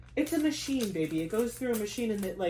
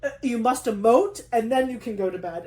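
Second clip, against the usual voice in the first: bossy body voice; 2 semitones higher, 6 dB louder than usual. Retold or imitated. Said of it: "it was like".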